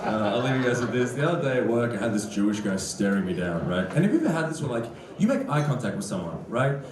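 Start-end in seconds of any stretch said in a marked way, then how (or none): none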